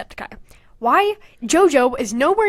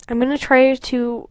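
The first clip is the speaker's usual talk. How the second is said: whispered to herself. Real, not acted. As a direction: say it under your breath.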